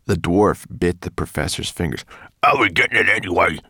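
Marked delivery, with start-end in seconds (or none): 2.4-3.6 quick, loud muffled protest. A narrator's reading. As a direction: talk through a hand over your mouth. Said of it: "he said in muffled tones".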